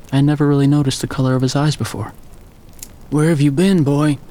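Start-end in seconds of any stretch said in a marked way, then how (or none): 3.1-4.2 a hint of a croaky old father, a bit louder; lightly voiced croaky old man; croaking old man. A narrator's reading. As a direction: rasp it out like a voice unused for days. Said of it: "his voice croaked".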